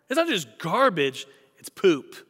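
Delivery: ordinary talk, nothing marked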